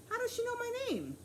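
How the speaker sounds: a startled double-take question. A narrator's reading. Transit cop startled that the toddler knows her name.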